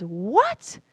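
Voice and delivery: can't believe his ears; incredulous. Acted. Little well frog demanding of the ocean frog in high incredulous squeak. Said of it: "the little well frog gets incredulous and says".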